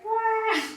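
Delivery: high, soft "wah" squeal; mock scream. Ken answering Barbie from the back seat, soft high mock scream. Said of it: "And he's like".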